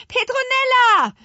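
high, loud shout for help; long, far-carrying child's holler; full-throated calling. A narrator's reading. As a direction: yell the name at the top of your lungs. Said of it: "riefen die Zwillinge aus voller Kehle".

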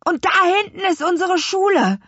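high, quick, thrilled exclamation; excited call. A narrator's reading. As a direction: spot it and blurt it out fast and high. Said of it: "rief Louis aufgeregt". (aufgeregt herausgerufen)

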